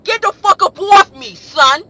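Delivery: very loud, high New York shout; New York accent. Acted. Mimicking New Yorkers who say son, shouted New York accent. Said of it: "Don't y'all say son a lot".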